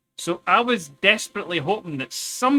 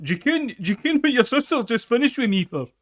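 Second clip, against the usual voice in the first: higher, louder put-on voice of a stranger; mock passer-by; 7 semitones higher, 6 dB louder than usual. Stranger at the party blurts a question to his friend, higher and louder. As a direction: lightly voice a random guy blurting it out. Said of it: "somebody walks past him like".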